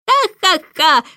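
loud mocking bird's laugh; Starling laughs at Jane from the window; loud laughter. A narrator's reading. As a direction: cackle from the window. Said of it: "громкий смех Скворца".

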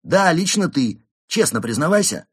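loud, high interrogator's order; insistent demand. A narrator's reading. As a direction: press the suspect to confess. Inspector presses the timid penguin. (громко и требовательно)

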